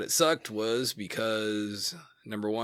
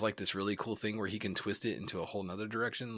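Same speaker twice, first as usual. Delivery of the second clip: ordinary talk, nothing marked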